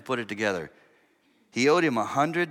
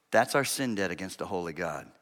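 ordinary delivery

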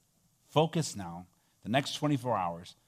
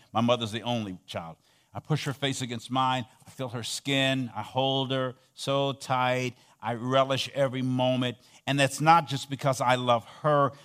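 normal talking voice

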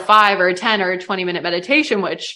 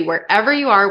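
ordinary talk, nothing marked

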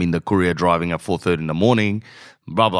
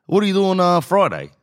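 much higher, fishing imitation of the brother-in-law; 10 semitones higher than usual. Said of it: "Good, bro".

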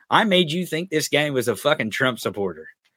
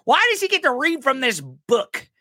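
high-pitched mocking whine, slowing at the end; mocking angry mom; 10 semitones higher than usual. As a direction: sneer like an indignant suburban mom. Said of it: "They're like".

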